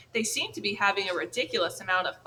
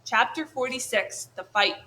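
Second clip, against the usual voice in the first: ordinary delivery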